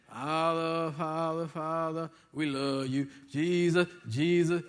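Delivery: mock flat, lifeless praying, barely voiced; lightly imitating a child praying with no spirit; spiritless prayer. Pastor mocking a listless child's prayer, mumbled low. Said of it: "you know, no spirit".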